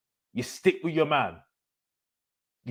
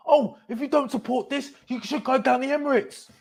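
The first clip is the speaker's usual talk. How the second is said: mock fan; 11 semitones higher than usual. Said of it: "It's like".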